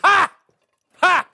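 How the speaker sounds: triumphant ha ha; gloating laugh. Acted. Gloats after fooling them.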